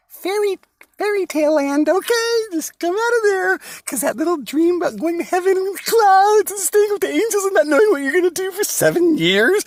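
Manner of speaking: shouted mocking rant; shouted preaching. Real, not acted. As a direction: blast it out like a fed-up preacher. Preaching rant, mocking heaven dream.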